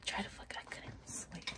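hushed morning whisper; whisper, people are sleeping; whisper yelling. Real, not acted. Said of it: "we're whispering because they're asleep".

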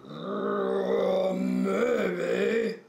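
hint of a slow, hesitant bear voice; lightly voiced polar bear. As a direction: play a slightly unsure polar bear. Polar bear character speaks.

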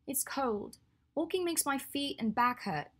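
high, whiny sulking complaint; sulky. Acted. Hermione complains to Draco about walking, sulky and high. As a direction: pout it out like a tired pregnant woman. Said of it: "she said sulkily".

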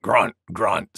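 low boar grunts; grunting wild boar. A narrator's reading. Wild Boar butts in, low grunting voice. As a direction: drop down low and grunt the words. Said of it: "said Wild Boar".

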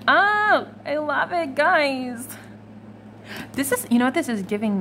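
high-pitched, adoring coo; gushing delight. Real, not acted. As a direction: coo over a cute cat, voice lifted high. Gushing over a cat on a card.

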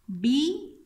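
"Bi" loud, high and rising; "Bi" drawn out with full force; stressed syllable. Shown as a demonstration. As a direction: punch "Bi" on its own. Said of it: "esa es la sílaba acentuada".

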